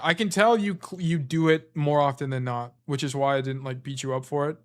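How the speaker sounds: normal talking voice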